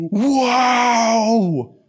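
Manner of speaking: breathless amazement; awed wow. Retold or imitated. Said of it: "turning towards each other going".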